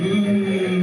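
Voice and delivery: long, level, drawn-out "ooh"; mock-impressed ooh. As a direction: hold a teasing oooh on one note. Mocking the fancy word fiancé.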